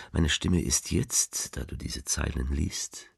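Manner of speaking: plain reading voice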